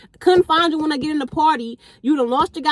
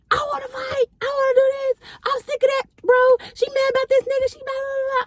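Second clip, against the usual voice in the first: put-on drunk friend yelling; 13 semitones higher than usual. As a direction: shout it like a wasted friend picking a fight. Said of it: "She just yelling".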